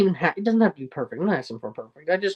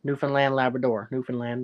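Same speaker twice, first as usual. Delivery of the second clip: usual speaking voice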